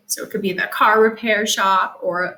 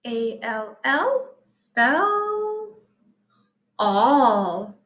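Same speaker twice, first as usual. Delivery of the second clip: spelling out slowly; much slower than usual. Shown as a demonstration. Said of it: "spelling letters".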